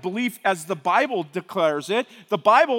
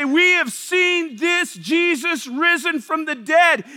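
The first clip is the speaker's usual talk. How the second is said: loud slow shout, higher; apostles proclaiming; 5 semitones higher, 4 dB louder, slower than usual. Acted. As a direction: shout the testimony. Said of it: "they get up and say".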